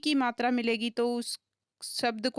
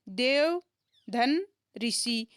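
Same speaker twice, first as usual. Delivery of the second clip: धन ऋषि said slowly and deliberately; teacher slowly breaking Devarshi into parts; slow split; much slower than usual. Shown as a demonstration. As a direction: take the join apart slowly. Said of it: "splitting Devarshi into parts".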